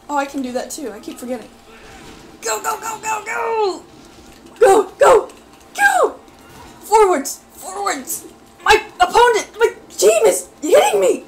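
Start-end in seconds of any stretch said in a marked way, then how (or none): 2.4-8.3 high, loud rapid commands; frantic shouting. Real, not acted. Player urging the fighter forwards, frantic shouts. 8.6-11.2 high, loud indignant shout; outraged yell, voice cracking. Real, not acted. Player hit by their own team, outraged yell. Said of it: "my voice cracked a little bit".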